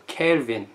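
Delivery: 켈빈 with an e vowel in the first syllable; English pronunciation. Shown as a demonstration. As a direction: say 켈빈 the English way, like Kelvin. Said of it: "영어식 발음은 또".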